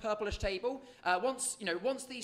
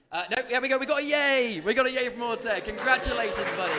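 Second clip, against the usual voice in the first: loud, high, joyful shout; excited cheering; 4 semitones higher, 8 dB louder, faster than usual. Real, not acted. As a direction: celebrate the winner out loud. Winner confirms in stream.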